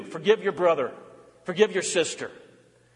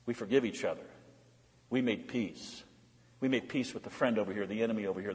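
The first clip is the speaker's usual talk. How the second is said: soft, tender exhortation; 7 semitones lower, 6 dB quieter than usual. Acted.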